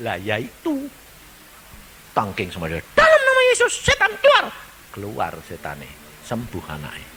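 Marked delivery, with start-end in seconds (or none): 2.9-4.5 commanding rebuke. Acted. Believer rebukes the demon, high commanding shout. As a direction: drive the demon out.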